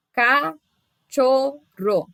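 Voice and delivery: "Cachorro" as ca, cho, rro with clear pauses; syllable by syllable. Shown as a demonstration. Teacher showing digraphs stay together, syllable by syllable. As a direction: say "Cachorro" very slowly in three separate syllables. Shows digraphs are not split.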